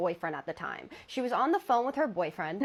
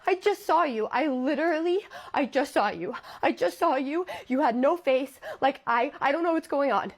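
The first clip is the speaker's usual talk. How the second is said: higher, louder frightened rush; panicked; 5 semitones higher, 4 dB louder than usual. Retold or imitated. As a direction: blurt it out scared and shaken. Terrified girl to fiance.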